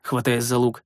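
ordinary delivery, nothing marked

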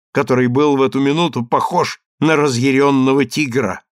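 usual speaking voice

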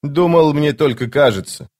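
ordinary delivery

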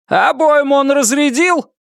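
high, urgent voice through static; over the radio. A narrator's reading. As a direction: push the words through a crackly radio. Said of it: "Голос его был невыразительным и далёким".

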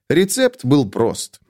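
plain reading voice

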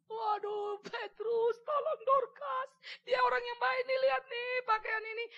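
high, loud sobbing plea, slow at the start; grieving widows beg Petrus, crying. Acted. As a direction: wail to Petrus to save Dorcas. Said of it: "para janda-janda nangis semuanya".

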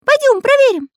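very high, insistent squeak; insistent Карандаш. A narrator's reading. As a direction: squeak it stubbornly. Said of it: "твердил Карандаш".

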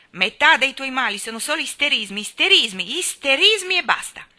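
loud, rising scold hammering isterismi; girl scolding her sick cousin; stamping anger. A narrator's reading. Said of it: "batteva con forza un piede".

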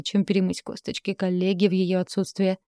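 plain reading voice

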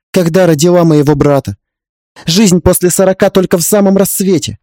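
impassioned urging. Acted. Heroine urging an older woman to leave, impassioned.

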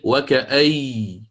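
وكأي said slowly, ending on a stressed doubled ya with no nun; doubled ya at the pause. Shown as a demonstration. Teacher shows students Abu Amr's pausal form, slow and deliberate. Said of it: "يشدد الياء".

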